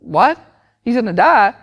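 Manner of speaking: high, loud disbelieving parent; mock shock. Acted parent shocked by doctor's news.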